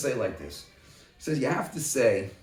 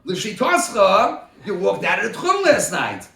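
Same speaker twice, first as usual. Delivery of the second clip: louder and higher, hammering the point home; raised voice laying down the law to a study partner; emphatic ruling; 4 semitones higher, 7 dB louder than usual. Real, not acted. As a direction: press the ruling hard on a student. Heated ruling in Talmud debate.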